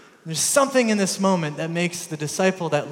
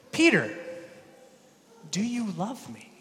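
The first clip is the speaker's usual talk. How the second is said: tender question; 3 semitones higher, 4 dB quieter than usual. Retold or imitated. Jesus asks Peter tenderly.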